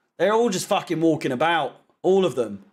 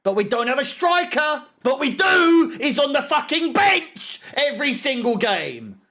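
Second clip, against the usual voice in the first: higher voice rising to a shout; exasperated rant; 6 semitones higher than usual. Real, not acted. Fan shooting down excuses about the striker, exasperated shout.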